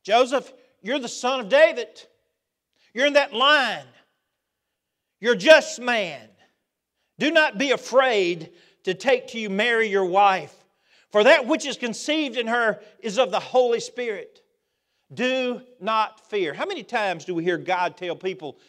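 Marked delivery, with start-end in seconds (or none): 0.0-2.0 Joseph called out loud and high; God's voice booming at Joseph. Shown as a demonstration. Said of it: "That's the genealogy". 2.9-16.5 loud, high proclaiming voice, slowing on the last words; God commanding Joseph not to fear. Acted.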